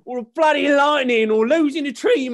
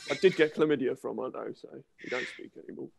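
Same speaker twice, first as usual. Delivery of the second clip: hushed confession; 11 semitones lower, 12 dB quieter, faster than usual. Acted. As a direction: drop to a near whisper for the shameful bit. Embarrassed confession about chlamydia.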